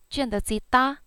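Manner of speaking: ordinary talk, nothing marked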